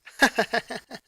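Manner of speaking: bright amused laugh; girl laughing at the stuffed rabbit. Acted. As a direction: burst out laughing at him. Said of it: "Ronaldo couldn't help but laugh".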